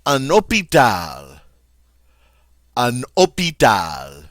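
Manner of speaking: teacher modelling "Un hôpital"; model French pronunciation. Shown as a demonstration. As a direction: say "hôpital" with no H, slowly for repetition. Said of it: "the H is silent".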